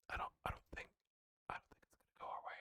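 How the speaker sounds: fast nervous whisper. Real, not acted. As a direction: whisper it close to the mic. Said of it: "I'm whispering into the mic".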